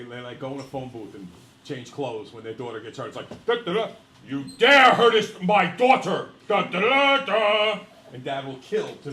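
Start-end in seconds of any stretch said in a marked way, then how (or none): 3.4-7.8 loud, high hero fanfare and booming threat; Superman dad. Acted. Dad turns Superman when his daughter is hurt, mock-heroic shout. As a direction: play a hammed-up superhero father to the rescue. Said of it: "like, Superman".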